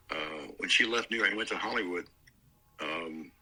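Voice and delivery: ordinary talk, nothing marked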